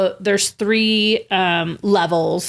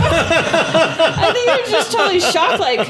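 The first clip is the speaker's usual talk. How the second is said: high, loud laughing disbelief; 9 semitones higher, 4 dB louder than usual. Real, not acted. Recalling the shocking farewell to a friend, laughing. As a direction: laugh it out, still stunned.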